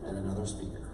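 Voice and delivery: low, soft, unimpressed drone with long pauses; weary, underwhelmed deadpan; Nicole. Retold or imitated. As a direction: imitate a jaded audience member, voice sinking. Imitates Nicole's unimpressed answer.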